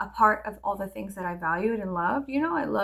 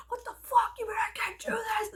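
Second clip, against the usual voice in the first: high, whispered outrage; 12 semitones higher than usual. Retold or imitated. Teen outraged at the teacher, high whispered disbelief. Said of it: "I was like".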